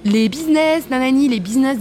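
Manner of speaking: high, sing-song boarding call; airport announcer. Retold or imitated. Mimicking the gate announcer calling business passengers. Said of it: "il y a la voix, vous savez, qui dit".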